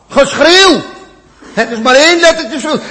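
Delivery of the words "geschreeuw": the word geschreeuw cried out high and loud; mock outcry. Preacher crying out the Hebrew outcry word, high and loud. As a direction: cry the word out like a scream.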